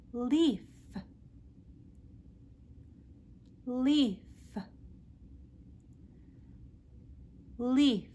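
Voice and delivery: leaf, clear L, short; teacher repeating leaf; modelled L word. Shown as a demonstration. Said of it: "Practice with me".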